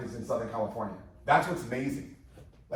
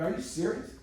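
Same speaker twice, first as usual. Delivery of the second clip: high surprised question; 8 semitones higher, 3 dB quieter than usual. Retold or imitated. Retells disbelief at buddy's news.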